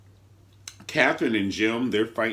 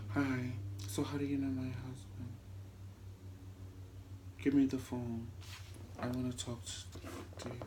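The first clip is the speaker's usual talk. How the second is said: low, much softer creepy voice; talking low; 14 dB quieter than usual. Retold or imitated. Veronica at Erica's door asking about her husband, quiet menace. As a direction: hush it like a menacing stalker. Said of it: "talking all low and shit".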